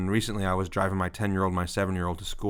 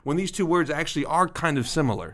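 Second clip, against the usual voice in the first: higher-pitched, mildly indignant protest; retold self-defense; 8 semitones higher than usual. Dad defending himself to laughing daughter, mock indignant. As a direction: plead the case like an affronted dad. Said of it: "I'm trying to defend myself".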